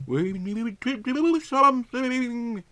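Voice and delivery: normal talking voice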